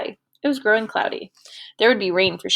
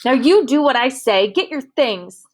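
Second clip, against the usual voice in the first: louder, higher commanding snap; temper flaring; 5 semitones higher, 6 dB louder than usual. A narrator's reading. Girl ordering her former servant, loud and angry. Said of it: "Susie's temper flared again".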